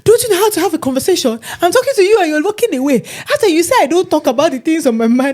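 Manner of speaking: high, loud, fast nagging friend; mimicking best friend. Mocking her best friend's nagging, high and loud. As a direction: squeal it like the pestering friend. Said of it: "She said".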